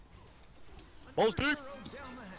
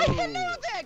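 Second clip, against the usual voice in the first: put-on voice; 4 dB louder than usual. Greeting the internet in put-on voice.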